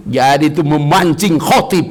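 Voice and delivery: ordinary talk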